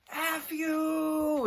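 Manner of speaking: high shouted heckle, far above his voice; crowd heckle. Retold or imitated. Hecklers yelling at the opening band, high shout. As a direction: yell it like a rowdy crowd member. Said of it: "people are going".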